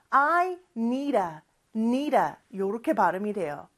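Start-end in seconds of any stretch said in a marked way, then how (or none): 0.1-2.3 "need a" run together as one word, like "nee-da"; linked "need a". Shown as a demonstration. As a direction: link the d of "need" into "a" with a light tap. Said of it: "빨리 발음을 하면". ("need a"를 한 단어처럼 이어서 발음)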